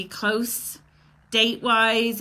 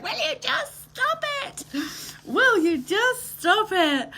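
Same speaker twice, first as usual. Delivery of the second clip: much higher, teasing put-on voice, slowing on the repeat; mock exasperated Jess; 10 semitones higher than usual. Friend Jess teasing the host about her tears, mock exasperation. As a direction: play the friend begging her to stop crying. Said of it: "Jess is like".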